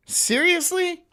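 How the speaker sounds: ordinary talk, nothing marked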